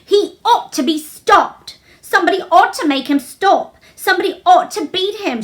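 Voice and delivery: crying out in anger. A narrator's reading. Girl crying out to the nurse, high and loud in anger. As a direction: rage at the unfairness, loud and high. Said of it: "she cried out".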